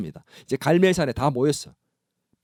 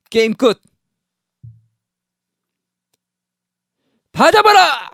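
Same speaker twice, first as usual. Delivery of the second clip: much higher, louder command; triumphant shout; 10 semitones higher, 10 dB louder than usual. Retold or imitated. Elijah ordering the people to seize the prophets, shouting. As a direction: bark the order to seize them.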